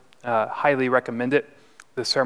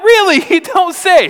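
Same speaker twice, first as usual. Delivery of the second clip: high, loud mock amazement; acted sarcastic retort; 8 dB louder than usual. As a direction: exclaim it with exasperated fake surprise. Sarcastic retort to the forgetful man.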